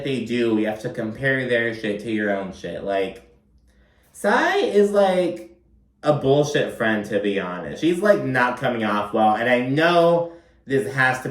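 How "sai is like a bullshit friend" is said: calling out a bad friend with disbelief; exasperated callout. Real, not acted. Vlogger calls out Sai to viewers, exasperated and pitched up. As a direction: pitch jumps up high, then settles.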